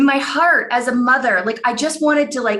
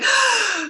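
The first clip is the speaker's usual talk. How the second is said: higher, imitated gasp of a crying girl; retold sobbing gasp; 11 semitones higher than usual. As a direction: catch your breath like a sobbing child. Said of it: "started crying and crying and sobbing".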